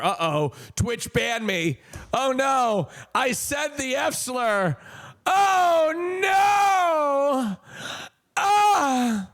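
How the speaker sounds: high, drawn-out fake "oh no" wail; mock wailing panic. Streamer pretending to dread a Twitch ban, mock wailing panic.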